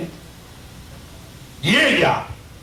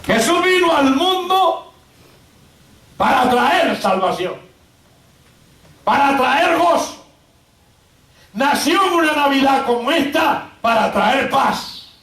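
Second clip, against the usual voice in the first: raised, measured pulpit proclamation; full-force preaching; 8 semitones higher than usual. Real, not acted. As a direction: preach it phrase by phrase with long pauses.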